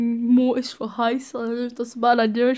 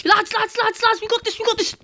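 much higher, urgent hype; excited rallying; 11 semitones higher than usual. Real, not acted. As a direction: pump up the squad. Rallying team.

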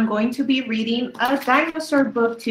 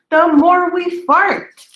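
higher, louder, slower punchline; gleeful punchline; 7 semitones higher, 7 dB louder, slower than usual. A narrator's reading. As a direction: land the silly word with a big burst for the kids. Funny punchline for children.

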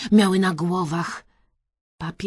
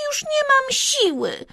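high, whiny little character voice; moaning Kreska. A narrator's reading. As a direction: moan it as if worn out, pitched way up. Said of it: "jęknęła w pewnej chwili Kreska".

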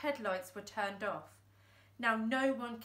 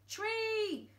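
much higher sudden shout; shouted warning; 12 semitones higher than usual. Acted. Thumbs spotting the tree, shouted. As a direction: warn them of the tree. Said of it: "shouted Thumbs".

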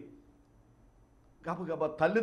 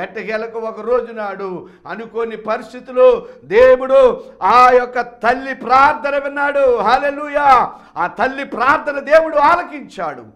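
loud, higher, driving sermon climax; preaching at full force; 7 semitones higher, 15 dB louder than usual. Acted. Preacher confronting his flock, loud and pleading. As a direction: hammer the question at the congregation. Preacher proclaims answered prayer.

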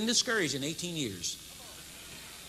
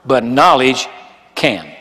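booming pulpit proclamation; loud slow sermon delivery; preaching at full force; 8 semitones lower, 16 dB louder, slower than usual. Acted. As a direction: thunder it out to the congregation.

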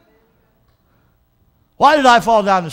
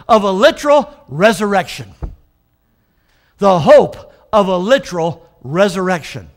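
louder, hitting "literal" and "hope"; preached emphasis; 6 dB louder than usual. Acted. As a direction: press the words "hope" and "literal". Preacher stresses phrase.

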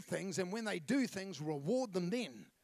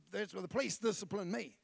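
normal talking voice

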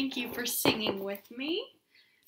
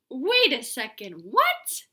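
mock shock; 7 dB louder than usual. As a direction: jump up in pitch in surprise.

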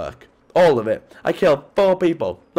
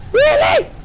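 very high, louder disbelieving really; incredulous squeal; 6 dB louder than usual. Real, not acted. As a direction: squeal it in outrage. Frustrated at game glitch.

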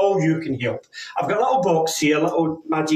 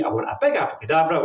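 ordinary talk

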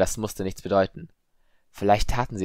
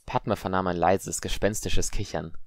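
ordinary delivery

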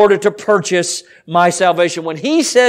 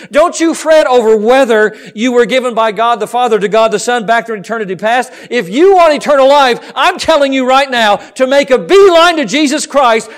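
loud, raised-pitch pulpit exhortation; ringing sermon appeal, hard on key words; full-force preaching; 6 semitones higher, 5 dB louder than usual. Real, not acted. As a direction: preach it urgently to the congregation.

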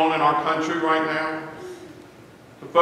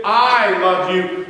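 "I" slow, loud and emphatic; stress on I; 3 semitones higher, 5 dB louder, much slower than usual. Shown as a demonstration. Preacher insisting that he is the one who truly loves. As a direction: insist on "I" as if your love was doubted. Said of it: "you're trying to set yourself out as the speaker".

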